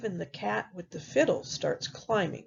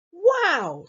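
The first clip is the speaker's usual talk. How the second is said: high, amazed piglet; high storybook pig exclamation; third little pig; 9 semitones higher, 4 dB louder than usual. Acted. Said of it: "says the third little pig".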